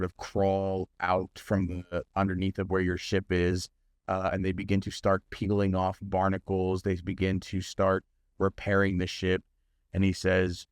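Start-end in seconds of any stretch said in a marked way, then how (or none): none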